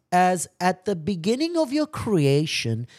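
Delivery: high, loud preaching read; fervent preaching. Real, not acted. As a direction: proclaim the quotation to the congregation. Preaches with building force.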